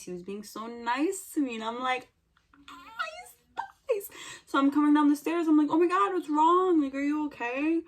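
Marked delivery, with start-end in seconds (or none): 2.7-4.1 very high, soft squeak; mock squeak. Mimics awe at Freddy being nice. 5.7-7.8 higher, hurried worry; acted concern. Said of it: "I'm concerned".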